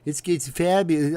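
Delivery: mock Trump bragging; Trump impression. Vlogger attempting a bad Trump impression. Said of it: "bad Alec Baldwin impression of Donald Trump".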